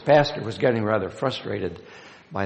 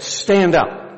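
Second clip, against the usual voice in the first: raised voice; 8 semitones higher, 8 dB louder than usual. Retold or imitated. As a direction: stand up shouted higher and louder. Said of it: "with a bang on the pulpit and a rise in his voice".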